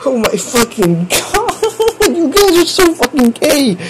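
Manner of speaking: high, laughing disbelief. Real, not acted. Streamer cracking up at chat's messages, laughing.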